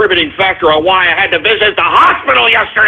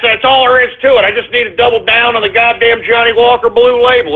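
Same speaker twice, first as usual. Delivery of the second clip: usual speaking voice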